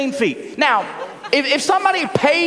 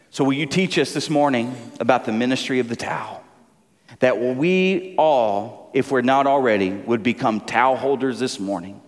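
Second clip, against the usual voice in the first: prayer; 10 semitones lower than usual. Real, not acted. Pastor praying for his congregation, low and measured. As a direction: bring it down low and pray unhurriedly.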